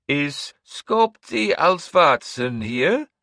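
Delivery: high, slow, loud crone call; old seeress Breda. Acted. Seeress calling out across the hall, high and slow. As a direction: summon the man like a sinister old witch.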